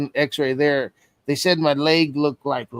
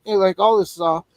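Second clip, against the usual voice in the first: ordinary talk, nothing marked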